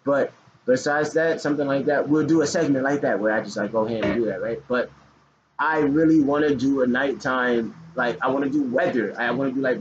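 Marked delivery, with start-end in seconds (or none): none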